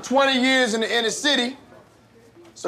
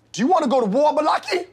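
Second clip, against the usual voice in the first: rising angry threat; threatening; 7 semitones higher, faster than usual. Acted. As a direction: challenge him, voice climbing. Teacher escalates at student.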